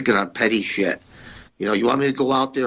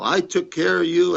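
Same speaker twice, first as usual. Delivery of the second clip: mock scream; 9 semitones higher than usual. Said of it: "Vince started screaming".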